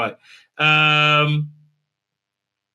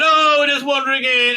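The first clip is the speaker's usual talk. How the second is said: higher, breezy mock voice; mock airy tone; 8 semitones higher, 5 dB louder than usual. Mocking the characters as they wander in, airy and higher. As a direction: toss it off like a careless intruder. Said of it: "And they just wander and said".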